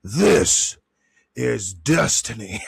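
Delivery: slow, dramatic proclamation; grand, drawn-out voice-actor line; voice-actor delivery. Pretend. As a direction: draw it out like a movie voice-over. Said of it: "I wanna be a voice actor".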